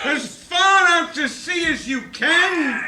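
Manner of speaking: high, loud, wildly excited shouts of the dance steps; excited shouting. Acted. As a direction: call out the dance moves at the top of your voice. Excited dance calling out together.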